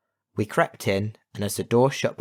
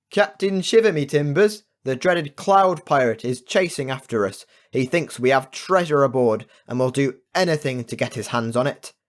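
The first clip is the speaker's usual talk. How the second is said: urgent warning; 6 semitones higher, 5 dB louder than usual. Acted. Captain warns of the pirate.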